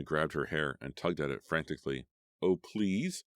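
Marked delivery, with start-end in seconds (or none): 2.4-3.2 desperate plea. A narrator's reading. Terrified begging on the edge.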